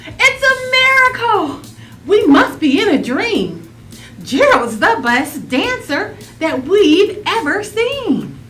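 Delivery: high, loud astonished cry; amazed shout. Acted. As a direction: call out in wonder. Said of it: "They shouted".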